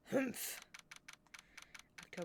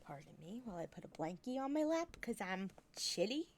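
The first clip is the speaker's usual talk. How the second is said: ordinary delivery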